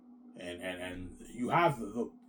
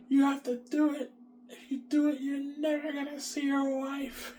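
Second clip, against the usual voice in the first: high mock voice; slower than usual. Voicing the ones who force him.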